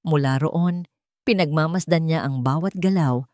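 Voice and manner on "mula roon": usual speaking voice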